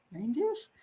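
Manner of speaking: "Reindeers?" asked softly with a rising tune; incredulous. Real, not acted. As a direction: question the word "Reindeers" in disbelief. Mocks the book's word.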